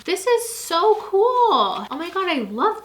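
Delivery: high, drawn-out delighted cool; excited squeal. Real, not acted. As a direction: stretch it out in delight. Reading the planner excitedly.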